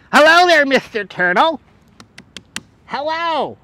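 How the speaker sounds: high, loud put-on hello; playful animal greeting. Streamer greeting Mister Turtle, playful put-on voice. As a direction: call out high and drawn to an animal.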